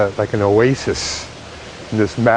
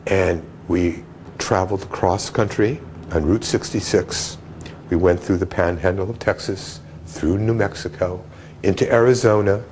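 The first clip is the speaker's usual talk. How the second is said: normal talking voice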